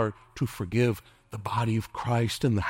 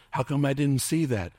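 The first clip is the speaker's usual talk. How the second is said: usual speaking voice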